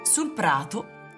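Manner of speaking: ordinary talk, nothing marked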